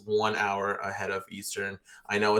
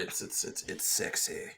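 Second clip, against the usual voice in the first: husky, whispered sick voice; 3 semitones lower, 8 dB quieter than usual. Real, not acted. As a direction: voice nearly gone from a cold. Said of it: "Don't mind, don't mind my, my sick voice".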